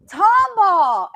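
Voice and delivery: called out loud and high; shouted hometown. Retold or imitated. Fan shouting hometown to the band on stage, loud call. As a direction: yell the town name to the stage.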